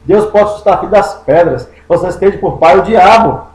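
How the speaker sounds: normal talking voice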